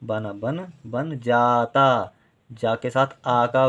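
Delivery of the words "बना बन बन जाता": बन जाता stretched out very slowly, each syllable held; slow spelling-out. Shown as a demonstration. Teacher showing pupils long vowels, drawn out. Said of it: "दोनों अक्षर देर देर तक हम बोल रहे हैं".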